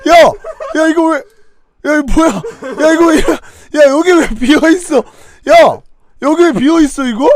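loud, exasperated yelling. Real, not acted. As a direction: shout at the screen. Yelling at the game outcome.

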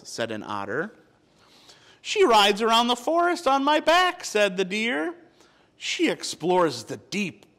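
lively storybook narration with voiced animals; reading to children. A narrator's reading. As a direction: tell it like a bedtime picture book. Reads picture book aloud.

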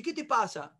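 lightly voiced concerned dad; understated, careful check-in; acted gentle father. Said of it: "está a mi nivel".

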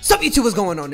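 high, loud hype greeting; energetic intro. Real, not acted. YouTuber opening the video, energetic greeting. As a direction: greet the viewers with big energy.

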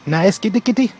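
high, sweet cooing to a kitty, rising at the end; coaxing a cat. Retold or imitated. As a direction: pet the cat with your voice. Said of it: "rub me head and dey pan".

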